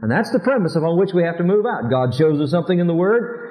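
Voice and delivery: ordinary delivery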